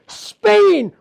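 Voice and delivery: loud, high cry of the place name. Acted. Tig crying out his destination as he flies. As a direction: fling the word out as he takes off. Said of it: "he cries out".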